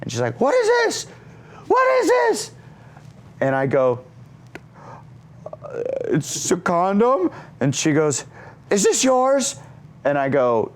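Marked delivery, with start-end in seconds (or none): 0.4-2.5 very high-pitched imitated demand; outraged mom. Mom confronts him holding condom. 5.4-7.3 high, slow, hesitant reenacted admission; sheepish son. Caught son admits it to mom. 8.7-9.6 high-pitched imitated interrogation; accusing mom.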